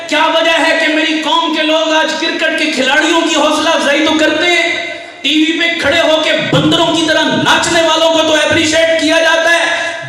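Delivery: high, loud, sustained oratory; preaching at full force. Real, not acted. Preacher urging the nation to appreciate Quran memorisers, full-force preaching. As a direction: cry it out to the whole gathering in long, ringing phrases.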